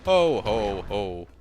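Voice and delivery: big belly laugh, falling low; punchy, deep-dropping festive chuckle; Santa Claus. Pretend. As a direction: boom out a mock jolly ho-ho. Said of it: "we are going to become Santa Claus".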